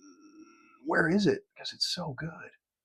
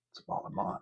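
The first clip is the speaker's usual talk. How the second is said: whispering; 21 dB quieter, much faster than usual. Real, not acted. Whispered repeat of the phrase.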